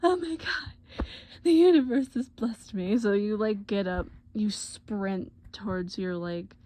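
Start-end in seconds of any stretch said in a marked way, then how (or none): 0.0-3.0 high, loud gush of joy; gushing relief. Acted. Girlfriend seeing boyfriend at the window, loud overjoyed gush. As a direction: draw it out in high, overjoyed awe. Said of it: "you're like".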